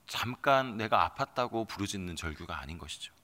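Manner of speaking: ordinary delivery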